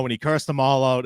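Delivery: ordinary talk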